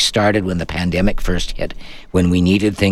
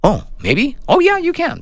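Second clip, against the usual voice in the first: rising surprised oh yeah; surprised realization. Retold or imitated. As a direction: pitch jumps up on discovery. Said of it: "and I go".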